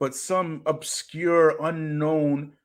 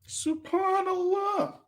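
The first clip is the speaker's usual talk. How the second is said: high, slow, stretched out; a long, high Subhanallah; drawn-out exclamation; 4 dB quieter, slower than usual. Real, not acted. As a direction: sing it out in mock disbelief.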